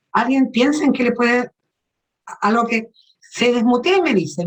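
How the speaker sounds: ordinary delivery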